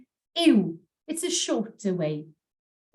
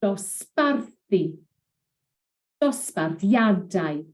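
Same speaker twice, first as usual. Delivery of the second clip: dosbarthu slow, stress on "bar", dosbarthiadau stress on "thi"; penultimate stress; slower than usual. Shown as a demonstration. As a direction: shift stress toward the end. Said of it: "the stress moves on each time".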